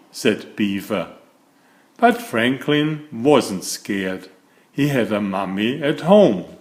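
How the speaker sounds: put-on lively read-aloud for small children; gentle, animated picture-book narration; storybook reading. A narrator's reading. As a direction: tell it like bedtime story time.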